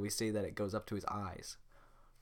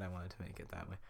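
ordinary talk, nothing marked

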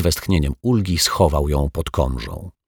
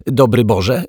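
put-on higher, louder flustered altar boy; groaning; 5 semitones higher, 5 dB louder, slower than usual. A narrator's reading. Altar boy fumbling his greeting, groaning. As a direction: blurt it with an effortful groan. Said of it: "stęknął ministrant".

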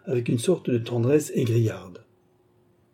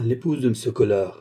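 plain reading voice

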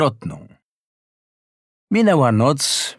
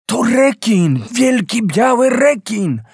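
awed fear; 10 semitones higher, 3 dB louder than usual. A narrator's reading. Son names the approaching shark.

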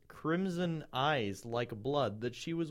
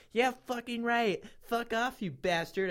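high-pitched read-aloud indignation; half-hearted acted outburst; mild mock scream; 7 semitones higher than usual. A narrator's reading. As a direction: spit the insult without really screaming. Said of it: "I screamed".

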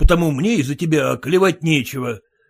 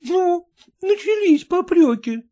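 tearful. Acted. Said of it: "Жена заплакала".

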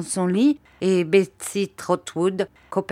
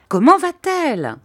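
insistent demand; 10 semitones higher, 6 dB louder than usual. Acted. Aunt insists loudly.

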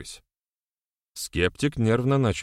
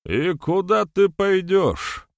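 high, drawn-out, loud taunt; taunting skeptic; 11 semitones higher, 5 dB louder, much slower than usual. A narrator's reading. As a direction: needle him slowly in a raised voice. Skeptic taunts the man leaving. (ехидно, протяжно и высоко)

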